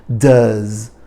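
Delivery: "Does" with a soft buzzing z at the end. Shown as a demonstration. Teacher modelling a soft z in "Does". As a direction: end "Does" on a gentle z sound. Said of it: "É um Zzinho suave".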